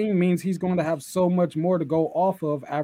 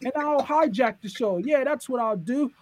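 higher, taunting heel promo impression; mock Waller; 10 semitones higher than usual. Mocking Waller taunting Sean from the ring. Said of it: "Jackass Waller, he says".

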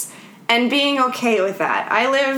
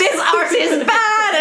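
high, drawn-out mock condemnation; booing priest; 9 semitones higher, slower than usual. Priest condemning the painter's picture, high hammed-up slow jeer. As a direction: draw it out high like a comic heckle. Priest's mocked verdict, then boos.

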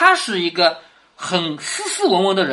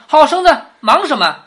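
raised, higher voice calling him back; urgent call to stop; 7 semitones higher, 8 dB louder than usual. Acted. Elders call to Baoyu to stay, raised and urgent. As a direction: hold the boy back with a loud high call.

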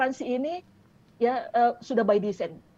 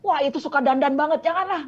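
higher, louder acted objection; mock disapproval; 4 semitones higher, 6 dB louder than usual. Relative warning against marrying someone who dresses up, mock disapproving. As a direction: exclaim it like a scandalised warning, rising on the last word. Elder warns against a marriage choice.

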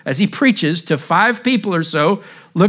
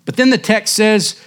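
raised, impassioned old country preacher, a hint of an English accent; a touch of an English country accent, preaching hard; slipping into the preacher's accent; 5 semitones higher than usual. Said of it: "can't help it".

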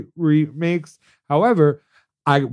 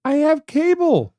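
indignant; 13 semitones higher, slower than usual. Acted. Indignant insistence.

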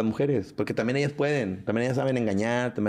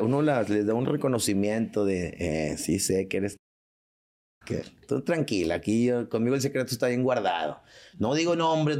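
usual speaking voice

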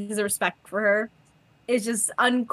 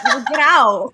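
loud brusque get out through laughter; laughing dismissal; 5 semitones higher, 10 dB louder, slower than usual. Retold or imitated. Rodney telling visitors to leave, loud laughing. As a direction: shoo them away, laughing. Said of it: "He's like".

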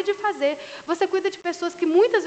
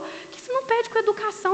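mimicking husband; 8 semitones higher than usual. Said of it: "Porque ele falou".

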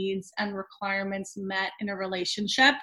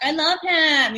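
lovestruck woman; 7 semitones higher, 6 dB louder, slower than usual. Retold or imitated. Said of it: "women do this all the time".

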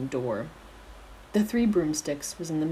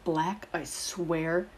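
slow, drawn-out warning; glaring warning; 2 semitones higher, much slower than usual. A narrator's reading. Friend warning the one who teases him, slow glare. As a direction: stretch each word out as a threat. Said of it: "Remus glared at him, open-mouthed".

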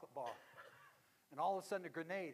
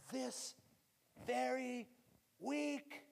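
preaching climax; 6 semitones higher, slower than usual. Real, not acted.

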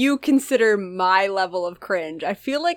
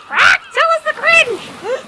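loud high call; 6 dB louder, slower than usual. Acted. Mock sea-shanty call.

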